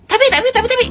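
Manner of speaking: repeated but rising very high; teacher's shrill-pitched insistent tapi. Acted. Teacher stresses an exception.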